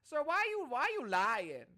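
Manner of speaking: high, loud mock urban accent; Adrian's urban accent. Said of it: "very urban yung accent ni, ano, ni Adrian".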